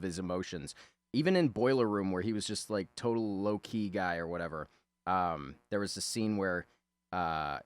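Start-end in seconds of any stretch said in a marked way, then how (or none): none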